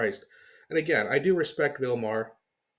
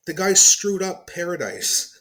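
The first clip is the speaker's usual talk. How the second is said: exasperated outburst; 5 semitones higher, 7 dB louder, slower than usual. Real, not acted. Ranting about California.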